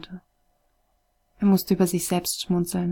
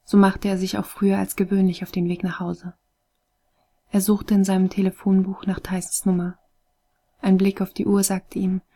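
plain reading voice

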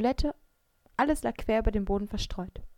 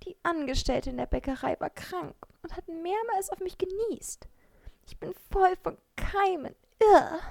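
much higher, whiny creature voice with a gross Irr; a tiny bread lamenting its germs; wailing bread; 10 semitones higher, 3 dB louder than usual. A narrator's reading. The bread complains to Maike about being sneezed on, whining high. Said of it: "klagte es".

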